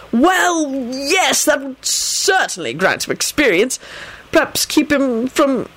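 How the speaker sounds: high, hesitant minister, breaking off; Fudge. Acted. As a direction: agree warily in a high official's voice. Fudge hesitantly agrees, then breaks off.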